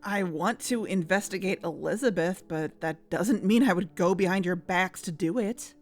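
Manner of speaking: ordinary talk, nothing marked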